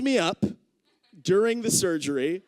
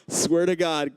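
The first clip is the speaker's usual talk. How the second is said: ordinary delivery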